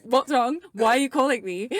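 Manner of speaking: high, loud mock alarm; hammed-up phone-call dread; panicked reply. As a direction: fire off worried questions in exaggerated panic. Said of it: "to give me the fear".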